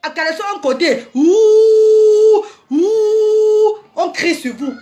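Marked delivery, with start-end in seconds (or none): 1.1-3.8 loud drawn-out booing ouh; mock booing. Leading the girls in booing irresponsible men, loud ouh. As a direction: boo them like a jeering crowd.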